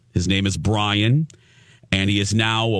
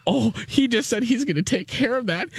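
put-on high, flustered little-girl gush; mock-swooning, high-pitched delight; giddy blushing; 12 semitones higher than usual. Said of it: "I'm blushing like a little girl".

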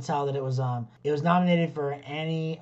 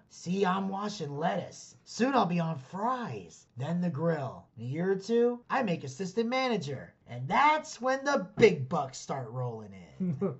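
slow, deliberate movie-quote impression; imitating an optimistic burger worker; Coming to America character; 2 semitones higher than usual. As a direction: stretch the words out in swooping proud tones. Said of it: "the guy from, uh, Coming to America".

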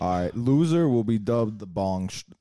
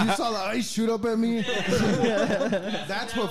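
laughing; 9 semitones higher, slower than usual. Real, not acted. Laughing after ice hit throat.

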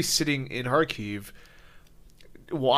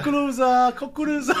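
mock corn hawker; 13 semitones higher than usual. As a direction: belt it out like a beach vendor, teasing. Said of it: "I'm out there yelling, selling corn in Russian".

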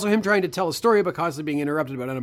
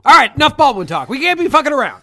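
mock stern cutoff; 8 semitones higher, 12 dB louder than usual. Real, not acted. Host cuts off tangent loudly.

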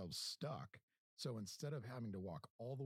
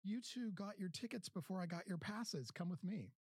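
higher-pitched imitation of a New Zealander; lightly put-on New Zealand accent, pitched up; sweet New Zealand accent; 10 semitones higher than usual. New Zealand woman bringing passes to two fans, imitated sweet New Zealand accent. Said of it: "sweet New Zealand accent".

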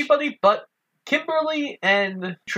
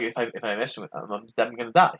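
much lower, soft, fast mumble; scared Billy; 11 semitones lower, 6 dB quieter, faster than usual. Retold or imitated. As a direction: mutter it like a terrified kid. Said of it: "it's like".

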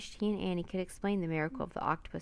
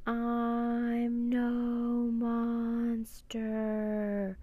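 very slow, higher, chanted on long notes; eerie creature wail; 4 semitones higher, much slower than usual. A narrator's reading. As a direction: stretch every word like a mournful sea creature. Giant octopus speaks eerily.

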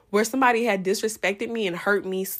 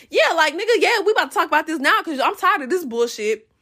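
retold angry yell; 7 semitones higher, faster than usual. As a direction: fire back at him, fed up. Confronting ex on the stairs.

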